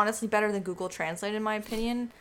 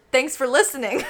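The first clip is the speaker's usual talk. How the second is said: laughing; 4 semitones higher, 9 dB louder than usual. Pretend. Mock sign-off through laughter.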